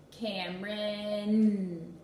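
"Cameron" very slow, each sound drawn out, final N punched; stretched name. Shown as a demonstration. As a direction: stretch "Cameron" out slowly, hit the last N. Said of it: "stretch out their whole name and punch out the last sound".

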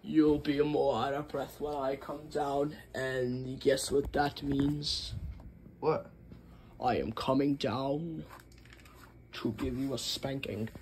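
low, soft threatening parent; lightly voiced stern father; menacing dad voice.

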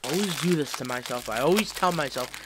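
ordinary talk

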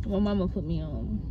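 ordinary talk

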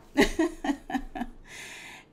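real breathy laugh; a long unvoiced chuckle; airy laugh. Real, not acted. Joking that her husband is out, an airy laugh.

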